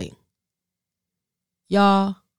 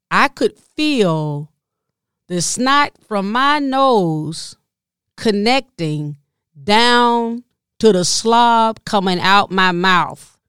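usual speaking voice

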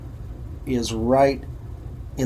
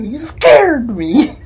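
You scared me, slow, higher and louder, each word stretched; drawn-out scolding; 8 semitones higher, 7 dB louder, much slower than usual. Retold or imitated. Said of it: "That's how I said it, too".

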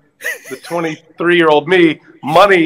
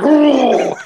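mock swerve noise; 8 semitones higher than usual. Bus swerving in retold story.

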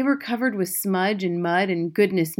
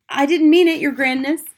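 high, loud pleading of a frightened witch; crying out; 8 semitones higher, 8 dB louder than usual. A narrator's reading. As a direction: plead in panic with the boss. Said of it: "the witch cried out".

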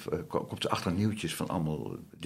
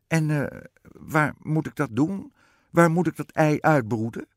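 high, hesitant then louder queen; raised storybook queen voice; bewildered queen; 9 semitones higher, 7 dB louder than usual. A narrator's reading. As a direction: ask haltingly, then press the question, as a queen. Queen anxiously questions the witch.

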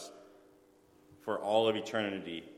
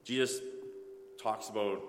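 usual speaking voice

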